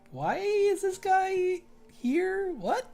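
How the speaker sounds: high, loud, drawn-out mock disbelief; exaggerated bafflement. Viewer reacting to an odd dubbed accent, baffled. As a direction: stretch it out in hammed-up, high-pitched confusion.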